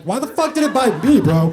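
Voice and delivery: why the fuck shouted, indignant. Retold or imitated. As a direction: yell at the skater, outraged. Angry retort to skater.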